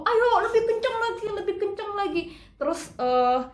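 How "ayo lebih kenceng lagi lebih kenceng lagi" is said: choir coach urging. Retold or imitated. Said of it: "kurnya suruh".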